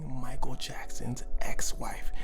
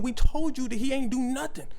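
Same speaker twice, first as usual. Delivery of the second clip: raised, high-pitched protest; mock indignant insistence; 10 semitones higher, 6 dB louder than usual. Family answering police about Michael, mock indignant insistence. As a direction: push it high and louder, fed up with being asked.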